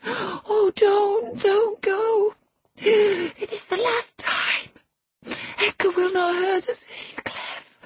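very high, frantic, tearful begging; shrieking plea. A narrator's reading. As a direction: beg hysterically, breath giving out. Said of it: "she shrieked".

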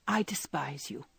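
hushed and grave, with a touch of sadness; soft, serious, almost sorrowful; grave, almost sad. A narrator's reading. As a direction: drop to a low, sober near-whisper. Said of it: "grave, almost sad accent of her voice".

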